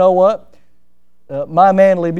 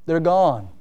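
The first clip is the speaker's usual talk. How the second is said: usual speaking voice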